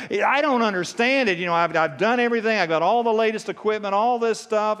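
high, loud frustrated complaint. Retold or imitated. Ice fisherman complaining about his useless gear, frustrated. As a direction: act out a baffled, frustrated grumbler. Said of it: "he is frustrated".

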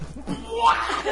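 very high, loud shout of disbelief; outraged shout. Retold or imitated. Ghost hearing that the victim forgives him, shouting in outrage. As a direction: shriek it in shock. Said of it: "the guy's like".

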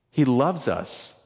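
normal talking voice

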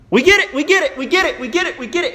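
high, loud, frantic squeal; panicked hyenas. Acted. Terrified hyenas flee Mufasa.

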